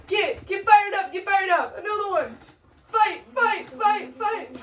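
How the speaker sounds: high, rowdy cheering chant; punchy ringside goading; egging on a fight. Acted. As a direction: whip up the crowd for a brawl.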